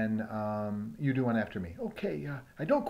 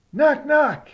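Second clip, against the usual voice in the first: higher, loud announcing knock; 7 semitones higher, 13 dB louder than usual. Pretend. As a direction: call it out at the door. Knocking loudly at the door.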